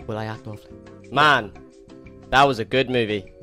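ordinary delivery

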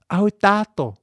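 high, bright-eyed girl playing mum; little girl voice. A narrator's reading. Child mother greets father. (hraný hlas holčičky)

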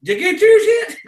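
high-pitched carnival barker's call-out; retold yell. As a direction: call out to passers-by from your game booth. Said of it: "I'd yell at 'em again".

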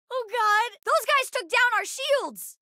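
high, urgent battle cry; high-pitched alarm; alarmed warning. Acted. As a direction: call out the damage in a panic. Alarm during a ship battle.

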